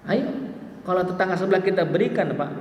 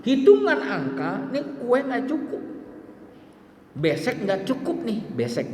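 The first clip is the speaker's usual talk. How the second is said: fretting hostess; 8 semitones higher than usual. Retold or imitated.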